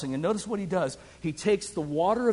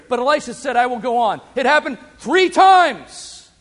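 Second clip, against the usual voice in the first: higher, rising preacher's voice building to a climax; fiery preaching; 6 semitones higher, 5 dB louder than usual. Acted. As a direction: preach it out with mounting fire.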